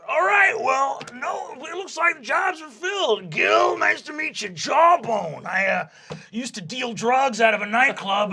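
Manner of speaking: a laid-back surfer werewolf yelling hey; hyped werewolf bro; party werewolf dude. Acted. Jawbone werewolf rant.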